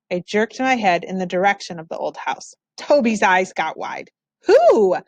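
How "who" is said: sharp high surprised "Who?"; little brother caught off guard; startled question. Acted. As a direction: blurt it out, startled. Toby startled by news.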